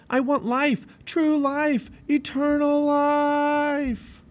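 high, loud cry, last words stretched long; shouting. A narrator's reading. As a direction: shout it out, building to a drawn-out cry. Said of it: "kept running and shouting".

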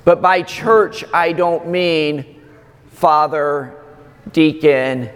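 ordinary talk

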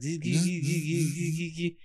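soft buzzing of a reclining seat; light mechanical buzz; seat motor buzz. Retold or imitated. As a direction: mimic a motor whirring. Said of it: "it was like".

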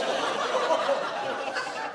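airy high giggle at his own joke; breathy giggle. Real, not acted. Pastor laughing at his own marriage joke, breathy giggle. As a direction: let a soft laugh slip out.

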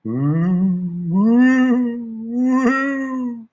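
rising, ghostly long ooh howl; eerie howl. Retold or imitated. Dog howling at the moon, heard as a ghostly wail. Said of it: "heard the noise again".

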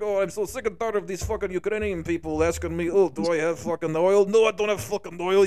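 exasperated Russian accent, pitched a bit up. Shown as a demonstration. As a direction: put on a Russian accent, sick and tired. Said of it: "Mm, Russian".

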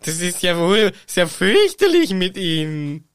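slow, prim and indignant; haughty Umbridge quarrel. Pretend. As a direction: scold her like a snooty headmistress. Acted quarrel of two Umbridges. (langsam, affektiert empört)

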